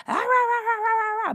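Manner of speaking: high-pitched gibberish argument noise; mock yelling. Dismissing back-and-forth arguments, mock yelling. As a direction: imitate a squabble with loud, high rah-rahs. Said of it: "going back and forth yelling".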